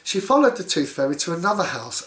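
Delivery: usual speaking voice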